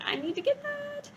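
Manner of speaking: high, eager little squeal; mock her own sudden need to buy it; eager high quote. Reader recalls spotting the new book, squeaky eager quote. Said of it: "and I was like".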